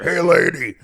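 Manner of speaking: loud, high growl of hey lady; wrestler promo voice. Acted. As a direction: bark it like a wrestler. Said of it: "don't call her brother".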